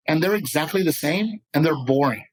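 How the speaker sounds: usual speaking voice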